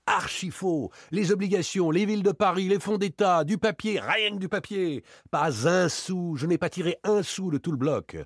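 high-pitched raging tirade; furious. Acted. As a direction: rail at the worthless paper, voice up high. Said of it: "S'écria-t-il rageusement". (rageur, indigné)

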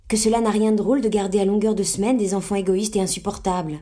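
plain reading voice

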